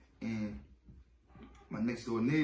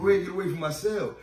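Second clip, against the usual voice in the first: much louder, higher shove-off line; retold shout; 5 semitones higher, 9 dB louder, faster than usual. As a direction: chase them off from the cell door. Shooing guards from his cell.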